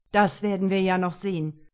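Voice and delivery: loud, forceful warning without raising pitch; threatening. A narrator's reading. Father warns daughter he may stop the wedding, menacingly. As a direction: deliver it as an open threat. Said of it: "sagte der Mann bedrohlich".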